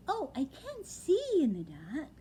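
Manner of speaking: high, small owlet voice; a young owl, wide-eyed and dazed; little owlet voice. Acted. Owlet telling his father, high and small. Plop's voice.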